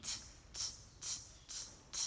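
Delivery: t said as a crisp unvoiced tap; phonics sound t. Shown as a demonstration. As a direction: tap out t, t, t softly. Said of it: "Can you remember what this one is?".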